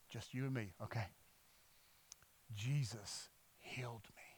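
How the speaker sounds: low, very soft confiding; secretive hush. Retold or imitated. Healed man lets out the secret, hushed. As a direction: whisper it with long pauses.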